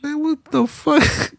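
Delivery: high, slow exasperated what the fuck; incredulous outburst at the comments; disbelief. Real, not acted. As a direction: stretch it out in disbelief.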